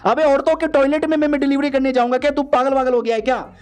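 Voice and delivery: high, fast outraged disbelief; shocked protest. Acted. Hero protests to friend about women's toilet, shocked and fast. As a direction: recoil at the idea, voice jumping up.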